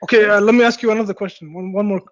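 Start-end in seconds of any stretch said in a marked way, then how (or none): none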